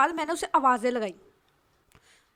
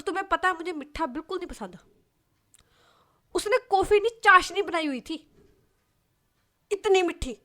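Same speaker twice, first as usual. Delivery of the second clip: indignant outburst; 5 semitones higher, 4 dB louder than usual. Acted. Friend outraged about sweet coffee.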